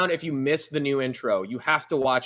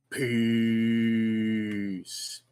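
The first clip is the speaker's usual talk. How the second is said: outro sign-off. Acted. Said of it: "until next time".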